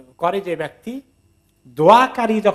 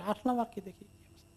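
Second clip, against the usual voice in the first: softer, fading into a whisper; whispered reply; 3 semitones higher, 14 dB quieter than usual. Retold or imitated. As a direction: answer under your breath, conspiratorially. Visitor answers in secret.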